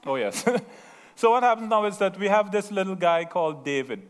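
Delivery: ordinary delivery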